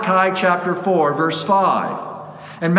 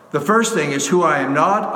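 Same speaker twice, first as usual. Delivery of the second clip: ordinary talk, nothing marked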